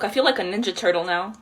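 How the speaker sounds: ordinary talk, nothing marked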